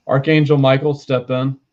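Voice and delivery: a commanding invocation to Michael; loud summons. Real, not acted. Medium calling the archangel in with a loud summons. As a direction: call the archangel in, loud and firm.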